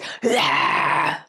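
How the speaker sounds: wordless imitated yell; mock yelling noise. Woman right in son's face, imitated wordless yell. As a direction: mimic someone yelling in a face with one raw noise. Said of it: "right in his face".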